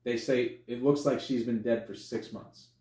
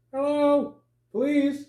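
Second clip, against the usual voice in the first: high, sing-song police knock-and-call; 13 semitones higher, 4 dB louder, slower than usual. Retold or imitated. Officer calling into a stranger's house, high-pitched call.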